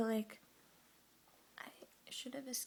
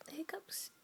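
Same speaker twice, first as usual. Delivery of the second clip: whispering; 4 semitones higher than usual. Real, not acted. Whispers throughout.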